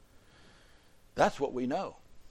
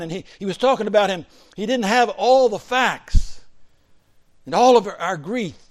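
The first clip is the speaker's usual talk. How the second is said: higher, louder preacher's crescendo; preaching at full force; 8 semitones higher, 8 dB louder than usual. Acted. Preacher crescendoing to the church, higher and louder. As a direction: build it up, swelling to a peak. Preaching Jacob's missing facts.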